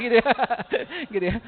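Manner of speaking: normal talking voice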